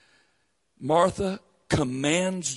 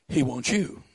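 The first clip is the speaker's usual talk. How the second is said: usual speaking voice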